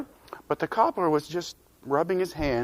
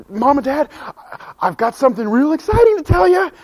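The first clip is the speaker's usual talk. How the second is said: higher and louder, nearly shouting with glee; bursting with excitement; 10 semitones higher, 6 dB louder than usual. Acted. Boy bursting to tell his parents his news, excited. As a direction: blurt it out, barely containing it.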